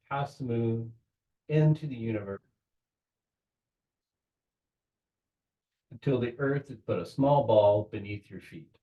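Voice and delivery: unhurried visualization with long pauses between phrases; measured meditation-leader voice; guided meditation. Real, not acted. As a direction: guide them gently, phrase by phrase.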